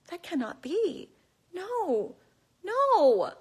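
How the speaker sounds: high, swooping dismayed denial; hammed-up "this can't be true"; mock disbelief. Mock dismay at Big Bird retiring.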